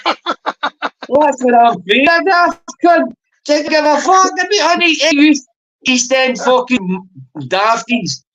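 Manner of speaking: high, slow put-on voice mocking back. Mocking reply in a quarrel.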